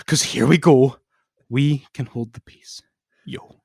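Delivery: swinging between loud declaiming and near whisper; hammed-up dramatic reading of wrestling lyrics. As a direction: read the lyrics like a dramatic poem. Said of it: "do a dramatic reading of the lyrics".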